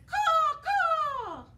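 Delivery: loud high cawing raven. Acted. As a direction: caw like a raven. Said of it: "Raven says".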